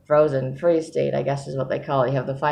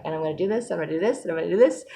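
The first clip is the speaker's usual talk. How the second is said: rising, listing plans with rising enthusiasm; mock eager; 3 semitones higher, much faster than usual. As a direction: play the eager planner. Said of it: "I'm like".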